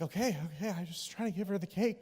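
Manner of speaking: soft, retreating self-defence; backing off. Retold or imitated. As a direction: imitate someone quietly backing down. Church lady backs off.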